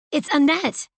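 high, loud exasperated yell; yelling back. A narrator's reading. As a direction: yell it back over the rain. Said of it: "she yelled back".

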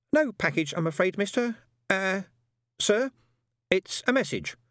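high, timid voice of a nervous delivery man. A narrator's reading. Delivery man nervously addressing Death, high and timid. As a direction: stammer it out like a frightened courier.

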